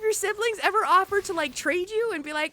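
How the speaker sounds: high, loud outraged rant; indignant yell. Retold or imitated. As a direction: protest loudly on mom's behalf. Ranting about selfish siblings.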